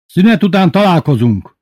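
high, loud parting warning; threatening teacher. A narrator's reading. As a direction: announce it as a threat. Angry teacher leaving class. (fenyegető, hangos búcsú)

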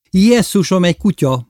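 high, loud startled shout; sudden cry. A narrator's reading. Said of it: "kiáltott Aranyhajú hirtelen". (hirtelen, ijedt felkiáltás)

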